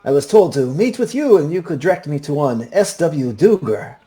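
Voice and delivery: put-on high, swooping Puss in Boots style accent; formal businessman introducing himself in a lilting accent; courteous newcomer, pitched well up, Puss in Boots accent; Pinto Esteban. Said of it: "This is the same accent I used".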